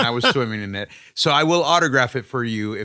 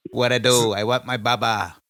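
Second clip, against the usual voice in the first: Joey impression; 3 semitones lower than usual. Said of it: "that's impression one".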